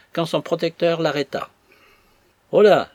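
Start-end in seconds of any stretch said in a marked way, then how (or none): 2.5-2.9 loud, higher calling shout. A narrator's reading. Master shouts to stop the boy leaving, loud. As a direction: stop him with a sharp call. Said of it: "lui cria-t-il".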